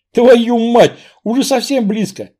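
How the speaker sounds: громкая тревожная ругань; выкрикнуть в испуге; испуганный возглас. Acted. Нападение, испуг рассказчика. (испуганный громкий возглас)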